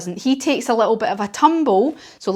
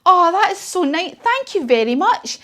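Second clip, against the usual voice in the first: Zog delighted; 8 semitones higher than usual. Acted. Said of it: "And Zog said".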